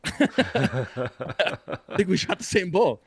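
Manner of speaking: slow, laughing through the words; laughing at the mix-up over the bull, slow. Real, not acted. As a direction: chuckle it out in disbelief.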